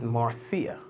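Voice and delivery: Marcia said softly and smoothly, MAR-see-a; sexy name. Shown as a demonstration. Host corrects the name Marcia, saying it sensually. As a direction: ease softly through Marcia with a rounded R. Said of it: "It's a sexy name".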